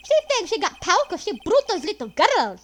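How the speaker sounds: loud mocking gangster voice; sneering thug. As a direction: gloat over her like a cartoon goon. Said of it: "that's the way I said it, though".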